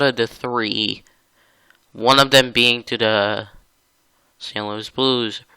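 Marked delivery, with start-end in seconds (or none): none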